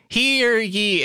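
high ringing town crier cry; town crier call. Pretend. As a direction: proclaim it to the whole square. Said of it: "what those town criers or whatever said".

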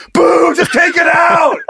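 boo like an angry fan; heckling crowd. Pretend. Acting booing crowd in stands.

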